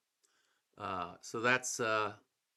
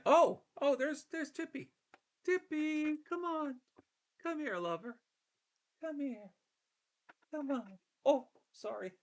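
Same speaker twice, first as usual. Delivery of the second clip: pet talk. Real, not acted. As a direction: coo at the pet up high. Fussing over his pet Tippy.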